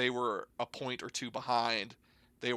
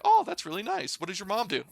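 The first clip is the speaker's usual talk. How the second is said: sweet teacher; 9 semitones higher, 4 dB louder, faster than usual. Retold or imitated. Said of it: "And they're like".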